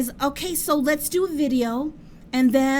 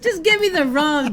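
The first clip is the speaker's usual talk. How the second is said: louder words through laughter; laughing; 5 semitones higher, 6 dB louder, slower than usual. Real, not acted. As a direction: laugh while brushing him off. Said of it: "I'm laughing".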